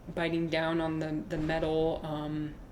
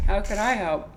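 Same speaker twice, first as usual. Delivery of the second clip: ordinary talk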